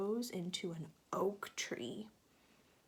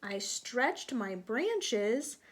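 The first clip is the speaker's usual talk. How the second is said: bright, lilting storybook narration, a bit louder; reading to children; 3 semitones higher, 6 dB louder than usual. A narrator's reading. Teacher reading a picture book to children, lilting storybook voice. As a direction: draw the little ones into the story.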